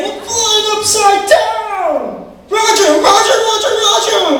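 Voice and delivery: high, loud, drawn-out yell; panicked yelling. Retold or imitated. Kid to the pilot while flying upside down, panicked shouting. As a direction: scream it as the plane flips over. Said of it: "I'm saying".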